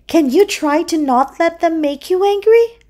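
high-pitched seahorse plea; coaxing seahorse. A narrator's reading. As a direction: coax in a high little character voice. Seahorse coaxes Puffy.

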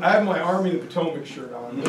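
ordinary delivery

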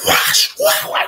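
high, shouted whack sounds; imitated whipping noises. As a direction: mimic a belt whooping with your voice. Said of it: "He went".

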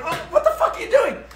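high-pitched, laughing disbelief; mock bafflement. Tourist questioning the rock-lifting stranger, incredulous. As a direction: squeak it out, half laughing.

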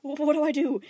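high, loud panicked cry; panicked yell. A narrator's reading. Panicking boy yells at his own thoughts, loud and high. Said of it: "he yelled through his thoughts".

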